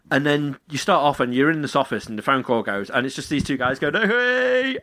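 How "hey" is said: mock drunk greeting. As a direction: call out like a tipsy guy. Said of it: "they just sound pissed".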